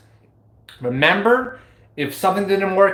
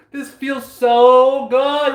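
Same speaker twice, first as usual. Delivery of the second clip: slow, louder, high gush; blissful; 7 semitones higher, 4 dB louder, much slower than usual. Real, not acted. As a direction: draw it out in delight. Ecstatic outburst.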